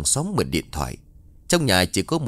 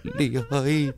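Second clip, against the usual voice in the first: despairing cry; 6 semitones higher than usual. Acted. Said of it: "tiếng khóc tuyệt vọng".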